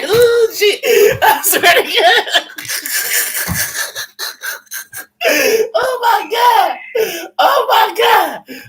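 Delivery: high wheezing laughter through the words; real helpless laughter, high and loud; laughing hard. Real, not acted. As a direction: lose it laughing, squealing. Cracking up at own story.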